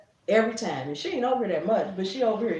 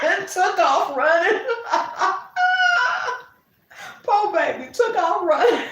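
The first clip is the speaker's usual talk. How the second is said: loud, high howling laughter through the words; can't stop laughing at the memory; shrieking with laughter, louder; laughing hard; 11 semitones higher, 8 dB louder than usual. Real, not acted. Laughing hard at toddler fleeing.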